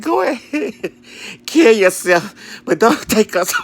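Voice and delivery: high, loud, drawn-out mock yell; slow down and bark it at them. Retold rant at strangers.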